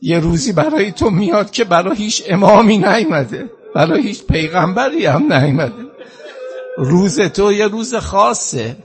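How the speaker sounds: high, tearful eulogy delivery; wailing lament. Real, not acted. Mourning preacher builds the lament.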